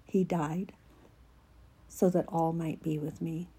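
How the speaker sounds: hushed, half-whispered reading of a prophetic word; soft devotional reading. Acted. Reading her written word to a child of God, softly half-whispered. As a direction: read it softly as the Lord's voice, tender and unhurried.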